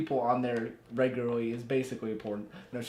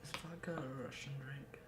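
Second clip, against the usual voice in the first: soft, conspiratorial whisper; 17 dB quieter than usual. Acted. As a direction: whisper it like a secret. Joking aside to co-host.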